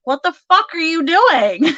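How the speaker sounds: loud, high mock outrage breaking into laughter; in-your-face. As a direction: demand it in disbelief, then crack up. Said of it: "in your face".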